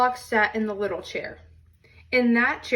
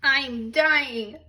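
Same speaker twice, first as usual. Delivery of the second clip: hammed-up little bear cry; Baby Bear's mock wail; 3 semitones higher than usual. Baby Bear to the family, hamming up a burnt tongue. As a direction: overplay a tiny bear's drama. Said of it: "cried Baby Bear".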